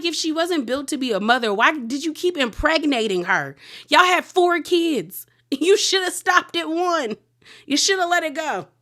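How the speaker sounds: exasperated rant. Real, not acted. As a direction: let him have it, outraged. Speaker rants at Bill.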